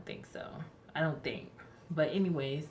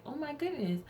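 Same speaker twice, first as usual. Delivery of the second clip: high mock gasp of surprise; doctor's high surprised oh; imitating a startled doctor; 11 semitones higher than usual.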